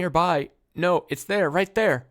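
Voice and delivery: high, loud urgent warning; urgent alarm. Acted. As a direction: insist the danger is right there. Enemy right in front.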